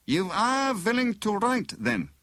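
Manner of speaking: high, foreign-tinged villain; bogus inspector. A narrator's reading. Bogus inspector checks prisoner will write, insistent. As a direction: probe with suspicious insistence.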